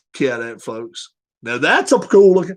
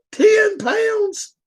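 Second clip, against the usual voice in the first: incredulous. Real, not acted. Disbelief at dog weight.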